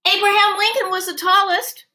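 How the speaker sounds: high, loud classroom call-out; eager student calling out in class; classmate yelling out. Acted. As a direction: shout the answer out from your desk. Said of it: "someone yells out".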